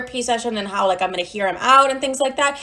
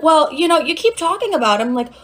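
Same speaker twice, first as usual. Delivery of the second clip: higher, casual therapist voice; imitated therapist; 6 semitones higher than usual. Therapist talking her into another chance, higher imitated voice. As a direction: pitch it up, breezily suggesting. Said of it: "She's like".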